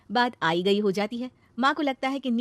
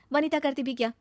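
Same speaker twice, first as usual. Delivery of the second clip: plain reading voice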